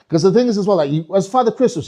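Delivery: usual speaking voice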